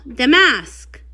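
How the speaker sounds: ordinary talk, nothing marked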